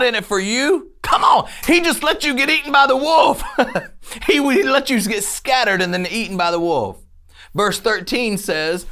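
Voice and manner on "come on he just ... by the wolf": laughing. Real, not acted. Laughing at the hireling image.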